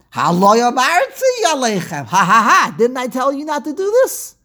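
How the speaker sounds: high, loud, taunting told-you-so; childish gloating. Retold or imitated. Reuven gloating over his guilty brothers, high taunting voice. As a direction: gloat like a nasty kid in the playground. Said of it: "how they talk in kindergarten".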